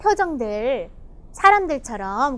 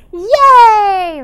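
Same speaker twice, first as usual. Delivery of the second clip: loud, high, drawn-out cheer; happy cheer; 6 semitones higher, 13 dB louder than usual. Acted. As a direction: cheer out loud like an overjoyed boy. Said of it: "He's so happy. He's like".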